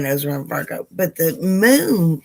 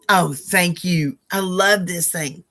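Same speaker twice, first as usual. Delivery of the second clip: usual speaking voice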